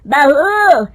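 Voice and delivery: a hint of a bossy elderly matron; mother-in-law greets a guest, then calls out loud for tea. Mother-in-law welcomes, calls to bahu.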